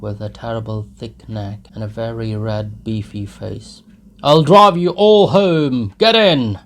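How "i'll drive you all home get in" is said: put-on boastful drunken shout. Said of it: "shouted one of them".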